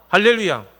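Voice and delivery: loud, high impassioned sermon; a pastor's fiery call from the pulpit; fervent preaching. Acted. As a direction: preach it out with full force, rising high. Preaching at full force.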